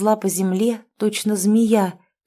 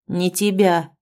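usual speaking voice

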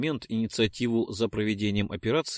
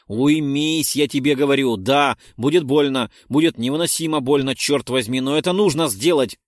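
loud, higher commanding voice; forceful shout; 3 semitones higher, 7 dB louder than usual. Acted. Narrator shouts at struggling Roldon to calm down. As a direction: shout him down firmly.

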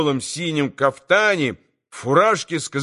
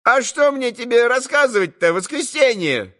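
shouting; 8 semitones higher than usual. A narrator's reading. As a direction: let fly at the boy, up high. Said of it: "раскричалась Марья".

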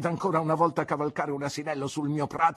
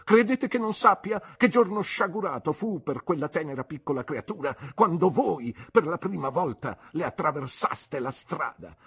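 aunt's indignant accusation; 6 semitones higher, 3 dB louder than usual. Acted. Aunt accuses Mr Marston.

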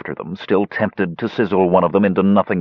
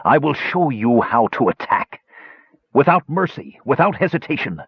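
higher, shrill cold commander; 6 semitones higher than usual. A narrator's reading. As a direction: snap out commands. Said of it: "his shrill voice calling".

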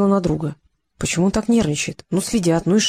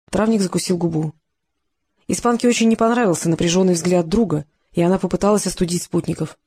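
usual speaking voice

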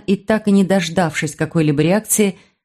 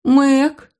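plaintive call; 6 semitones higher than usual. Acted. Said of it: "Голос мой прозвучал жалобно".

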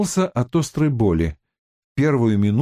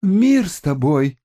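babbling; 6 semitones higher than usual. A narrator's reading. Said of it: "лепетал Хилон".

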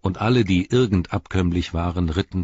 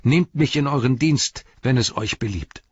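high, louder earnest appeal; fervent plea; 7 semitones higher, 4 dB louder than usual. A narrator's reading. Merry pleading with the king to take him into service. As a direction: beg to serve him, heartfelt. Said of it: "rief er".

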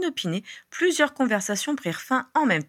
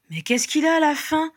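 high-pitched, fed-up wondering; exasperated question; 8 semitones higher, 3 dB louder than usual. A narrator's reading. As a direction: ask in baffled exasperation. Exasperated after the child's outburst. (question exaspérée)

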